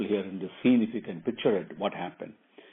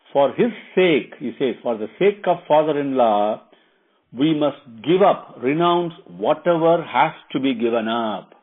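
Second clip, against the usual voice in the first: louder, emphatic wifely appeal; earnest wife Sita; 3 semitones higher, 7 dB louder than usual. Acted. Wife appealing for father-in-law's sake, earnest and louder. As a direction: press the point with force. Sita urges giving up everything.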